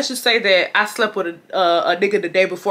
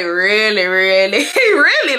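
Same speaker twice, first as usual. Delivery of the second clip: mock incredulous; 4 dB louder, slower than usual. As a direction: let each "really" build into a squeak. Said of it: "I'm looking like".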